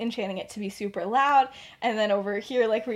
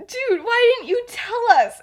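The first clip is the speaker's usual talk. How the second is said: high, loud, drawn-out mock protest; mock exasperation, pitched up; exasperated friend; 12 semitones higher, 7 dB louder than usual. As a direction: act out an exasperated question, slowly and higher. Acted exasperated friend in retold quarrel.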